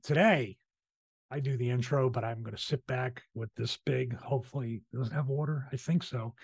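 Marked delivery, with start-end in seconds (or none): none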